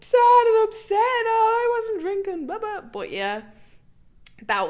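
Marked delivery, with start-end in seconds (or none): 0.0-2.8 mock whiny excuse. Teen faking a sad excuse to her ma, high whiny mockery. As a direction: ham up the crying excuse.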